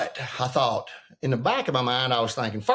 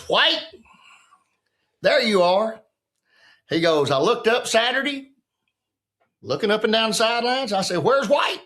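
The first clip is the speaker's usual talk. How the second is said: needling coach; 7 semitones higher than usual. Retold or imitated. Coach Holly needles the player.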